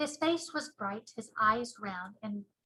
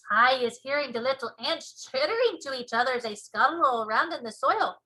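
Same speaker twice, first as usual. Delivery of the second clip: BFG giant voice; 2 semitones higher, 7 dB louder than usual. Acted. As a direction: show off the ears proudly.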